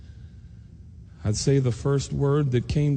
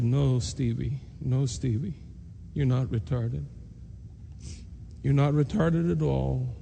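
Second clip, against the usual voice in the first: tender comfort. Retold or imitated. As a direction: hold him and soothe him gently. Mother comforts crying son.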